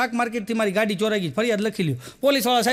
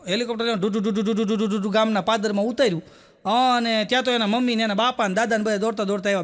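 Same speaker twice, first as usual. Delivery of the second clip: usual speaking voice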